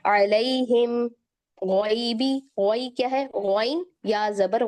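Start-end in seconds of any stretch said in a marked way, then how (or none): 0.1-2.3 slow अलैहिम with a clear ai; tajweed demo. Shown as a demonstration. Teacher models the Fatiha words slowly. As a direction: say अलैहिम as alaihim, not alehim. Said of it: "सूरत अल-फातिहा में भी था".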